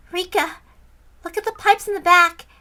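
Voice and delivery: put-on a girl pointing something out; Rika voice. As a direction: point out the pipes as a sharp child. Girl character voiced in story.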